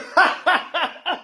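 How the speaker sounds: high-pitched burst of real laughter; helpless high cackle; big laugh. Real, not acted. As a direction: crack up at your own imitation. Cracks up at own imitation.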